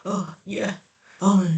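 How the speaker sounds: half-hearted West Virginian drawl, given up quickly; Joe Manchin. Retold or imitated. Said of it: "I can't do his West Virginian accent".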